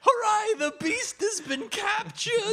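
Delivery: very high, hammed-up celebratory proclamation; mock rejoicing. Villager announces the beast is caught, hammy high rejoicing. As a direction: cheer it slowly like a storybook villager. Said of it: "And there was much rejoicing".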